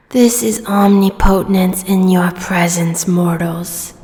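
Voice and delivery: ordinary talk, nothing marked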